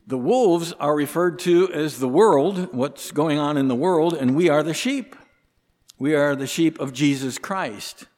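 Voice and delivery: rally the flock with conviction; preaching at full force. Acted. Preacher telling the congregation they are wise sheep, fervent and loud.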